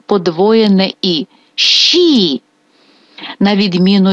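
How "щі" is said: long stressed І in Щі, high then falling; Щі with a high, held, stressed І. Shown as a demonstration. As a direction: draw out the doubled І in Щі. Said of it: "Наголос падає на І".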